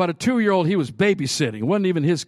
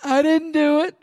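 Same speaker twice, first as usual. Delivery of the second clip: put-on toddler denial; 12 semitones higher, 4 dB louder than usual. Toddler denying it.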